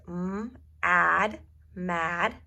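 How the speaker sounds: segmenting then blending mad; sounding out mad. Shown as a demonstration. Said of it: "Here we have three letter words".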